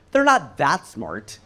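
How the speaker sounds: usual speaking voice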